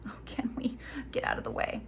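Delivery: soft unvoiced aside to the camera; hushed little muttered question; whispered aside. Real, not acted. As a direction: whisper it to yourself.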